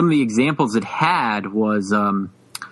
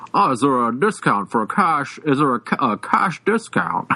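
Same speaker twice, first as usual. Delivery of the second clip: imitated upper-class accent; 2 semitones higher than usual. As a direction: imitate a genteel customer's accent asking politely. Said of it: "he had that".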